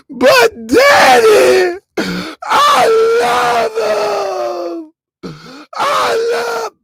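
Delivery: put-on high, loud, slow wailing girl; hammed-up crying daughter; whiny daughter plea. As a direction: drag it out like a heartbroken daughter.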